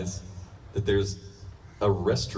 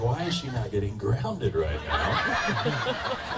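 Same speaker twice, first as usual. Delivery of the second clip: shocked disbelief; much slower than usual. Retold or imitated. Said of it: "I was like".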